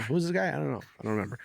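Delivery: high-pitched mock puzzlement, easing down; clueless call screener. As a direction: play someone who can't place the caller. Acting someone screening a call.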